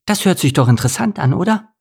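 put-on high, quick, bright girlish voice; excited nudge to a friend; high and fast with excitement; eager Steffi.

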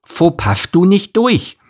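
high, slow lisping donkey warning; Elimar, urgent. A narrator's reading. Donkey warns the camel he is too wide, urgent high lisp. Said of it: "sagte Elimar eindringlich".

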